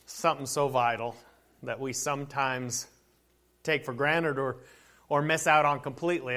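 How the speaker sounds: low, quiet public prayer; hushed, low opening prayer. Real, not acted. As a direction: pray softly and humbly to God.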